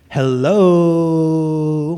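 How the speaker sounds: a long stretched "Hello" with the o held; drawn-out hello. Acted. Impatient texter nagging him for a reply, a long drawn hello. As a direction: drag "Hello" out like a thousand O's. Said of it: "with, like, a thousand O's".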